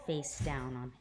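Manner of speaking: ordinary delivery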